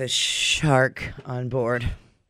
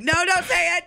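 pleading. Retold or imitated. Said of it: "everybody's like".

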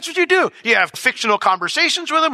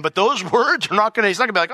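normal talking voice

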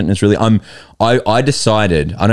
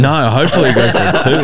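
laughing reply; 6 semitones higher than usual. Retold or imitated. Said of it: "And I'm like".

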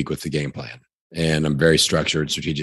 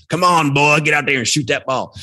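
put-on Indiana accent; 10 semitones higher than usual. Other coaches shouting at a kid on court, in a hammed-up Indiana accent. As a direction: yell it like a small-town Indiana coach. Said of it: "that's my Indiana accent".

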